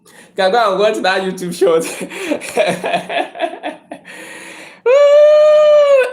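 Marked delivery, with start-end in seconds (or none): 1.9-4.6 airy unvoiced laugh; breathy laugh. Real, not acted. Laughing at his own roommate joke, airy. 4.9-6.1 high loud whoop. Real, not acted. Cheering his own joke to the live audience, loud whoop.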